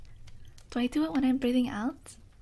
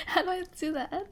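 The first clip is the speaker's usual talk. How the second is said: ordinary talk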